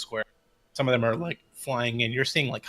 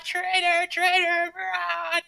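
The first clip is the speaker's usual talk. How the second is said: squawking ravens; slower than usual. Acted. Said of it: "the birds".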